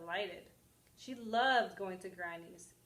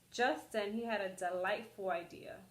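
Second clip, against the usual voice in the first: usual speaking voice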